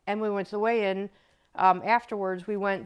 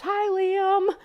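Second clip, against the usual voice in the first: cheery greeting; 10 semitones higher than usual. Retold or imitated. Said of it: "and she goes".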